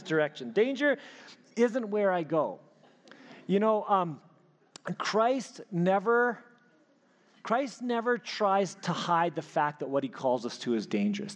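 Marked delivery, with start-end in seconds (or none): none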